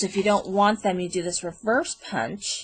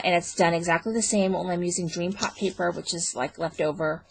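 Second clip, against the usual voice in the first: ordinary delivery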